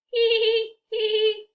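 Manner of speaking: high giggle; a little child giggling. Acted. Characters giggling together.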